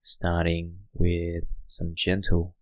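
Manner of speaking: hushed guiding voice for settling in; guided meditation. Acted. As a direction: ease the listener into stillness, gently.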